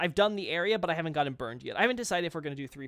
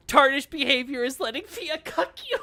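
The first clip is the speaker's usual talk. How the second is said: laughing; 9 semitones higher, 12 dB louder than usual. Real, not acted. Laughing loud at the scene.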